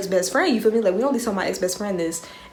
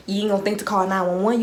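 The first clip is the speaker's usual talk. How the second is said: normal talking voice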